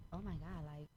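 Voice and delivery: normal talking voice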